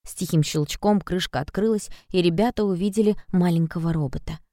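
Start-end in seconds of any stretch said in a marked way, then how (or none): none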